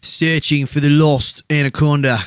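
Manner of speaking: mock Aussie adventure voice; Australian accent, hammed-up. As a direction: sell it like a Crocodile Dundee type. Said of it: "You do a really good Australian accent".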